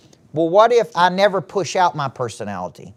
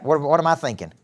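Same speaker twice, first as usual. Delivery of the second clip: usual speaking voice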